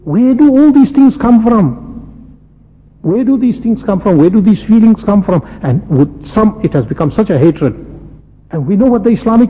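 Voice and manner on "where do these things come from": ordinary delivery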